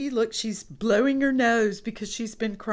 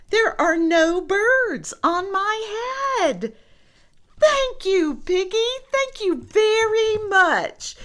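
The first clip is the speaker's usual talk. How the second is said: high, louder, happy thanks; overjoyed thanks; 11 semitones higher, 5 dB louder than usual. Acted. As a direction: gush with relief. Gerald thanks Piggy happily.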